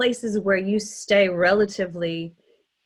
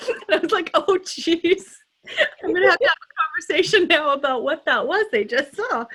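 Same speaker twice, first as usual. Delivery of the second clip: high, louder, laughing retelling of embarrassment; laughing mock dismay; 10 semitones higher, 5 dB louder than usual. Parent retelling dismay at what daughters saw, laughing. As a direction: squeal it through laughter as a mortified parent.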